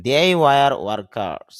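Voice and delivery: they were workers drawn out very slowly; slow, loud model for learners; careful teacher delivery; slow demo. Shown as a demonstration. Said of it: "তাহলে খেয়াল করেন".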